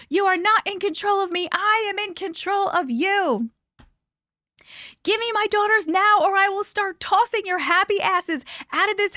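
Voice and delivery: high, loud retold scream. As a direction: scream it in her face, high and furious. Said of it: "I started screaming as loud as I could".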